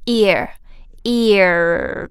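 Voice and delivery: "ear" ending in a curled-tongue r. Shown as a demonstration. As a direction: curl the tongue at the end of "ear". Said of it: "这儿卷舌". (ear结尾卷舌)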